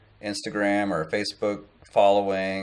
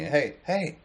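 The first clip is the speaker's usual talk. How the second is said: higher-pitched mild mock yell; retold yell; 7 semitones higher than usual. Old man calling after him down the road, mock yell. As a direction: call after someone, without real volume. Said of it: "he yells at me".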